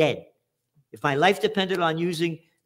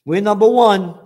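sarcastic chant, much louder; mock cheer; 3 semitones higher, 9 dB louder than usual. Mocking American obesity with a loud sarcastic cheer. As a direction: shout it like a mocking stadium chant.

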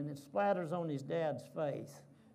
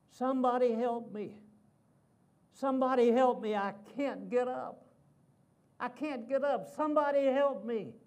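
loud, high pleading cry of a pinned man; crying out for help; 5 semitones higher, 5 dB louder than usual. Retold or imitated. Wrestler pinned by his son cries for help, loud and high. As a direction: call out for help, hammed-up and playful. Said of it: "and he's crying out".

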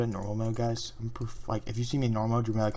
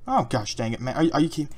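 ordinary talk, nothing marked

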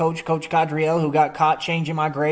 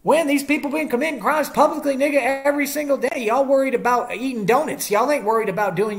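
heated rant; 7 semitones higher than usual. Real, not acted. Ranting at police who won't do their job, voice up high. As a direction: pitch jumps high, pressing the question.

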